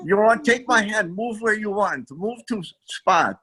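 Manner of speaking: slow, hammy fake Chinese accent; mock Chinese accent, dragged out; bad Chinese accent. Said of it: "that was the worst Chinese accent ever".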